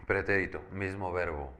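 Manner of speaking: ordinary delivery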